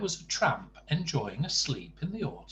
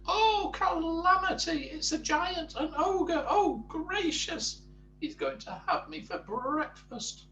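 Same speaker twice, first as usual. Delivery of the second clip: very high, squealing little-creature voice; panicked screaming; 4 dB louder than usual. A narrator's reading. Mister Jelly mistaking the tramp for an ogre, squealing panic. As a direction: wail it high in hammed-up terror. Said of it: "he screamed".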